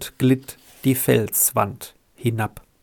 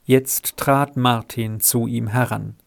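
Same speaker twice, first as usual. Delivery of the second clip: usual speaking voice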